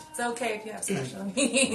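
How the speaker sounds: laughing. Real, not acted. Teasing right after laughing.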